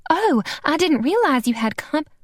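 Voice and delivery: high, lightly voiced genteel woman; breezy apology cut short; light, high Southern lady; Cora Lee's voice. Cora Lee enters, cut off.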